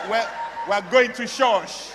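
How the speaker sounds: halting restart, then spoken up firmly. Acted. Suitor repeats himself to the father, speaking up.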